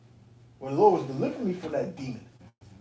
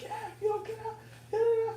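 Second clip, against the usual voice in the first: much higher, squeaky mock woman's voice; mocking her threat; 4 dB quieter than usual. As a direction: parody her nagging threat in a high voice. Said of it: "she's like".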